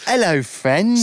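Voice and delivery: high, slow, drawn-out hello to new friends; sing-song hello. Pretend. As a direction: stretch the greeting out. Greeting new podcast friends.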